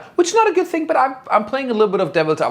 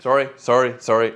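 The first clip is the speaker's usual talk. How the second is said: ordinary talk, nothing marked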